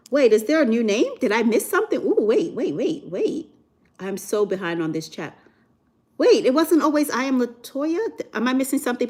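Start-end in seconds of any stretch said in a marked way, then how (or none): 0.1-3.5 higher, puzzled string of waits; real confused surprise. Real, not acted. Streamer reading chat is thrown by a new name, confused surprise. 6.2-9.1 higher-pitched baffled questioning; puzzled disbelief. Real, not acted. Streamer asks chat about the name, puzzled disbelief.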